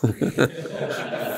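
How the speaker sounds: soft unvoiced chuckle; breathy laugh. Real, not acted. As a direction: laugh quietly at the joke. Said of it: "fake a heart attack".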